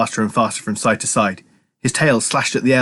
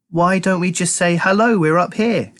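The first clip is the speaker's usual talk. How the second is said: innocently; 9 semitones higher than usual. A narrator's reading. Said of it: "said Keith innocently".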